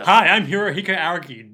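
loud, sudden self-introduction; big hammy presenter voice; mock TV-show host. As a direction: announce yourself like a show's hero. Plays TV hero introducing himself.

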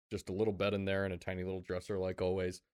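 normal talking voice

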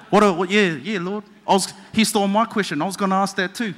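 jokey Kiwi disciple butting in; light Kiwi accent with a laugh. Acted. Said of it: "every disciple has a Kiwi accent".

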